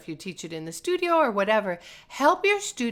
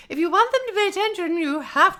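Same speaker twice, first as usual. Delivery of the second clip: much higher, fast, sing-song preschool-teacher lilt; musical teacher voice; 11 semitones higher, 5 dB louder than usual. Shown as a demonstration. Preschool teacher getting four-year-olds to listen, sing-song. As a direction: talk like you're grabbing four-year-olds' attention. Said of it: "very musical in your speech".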